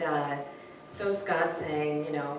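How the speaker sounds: usual speaking voice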